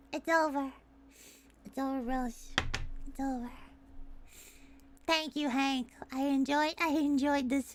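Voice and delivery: low, soft resigned delivery; mock defeat. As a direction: say it spent and quietly beaten. Mock defeat after embarrassing outburst.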